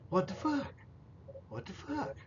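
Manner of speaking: hushed, high-pitched mock disbelief; imitated bewilderment. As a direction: squeak it softly, imitating a baffled man. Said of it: "Here comes Chuck back going".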